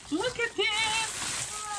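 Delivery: high, drawn-out squeal of delight; excited squeal. Real, not acted. Crafter showing viewers a new find, excited squeal. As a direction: squeal it slowly, stretching each word high.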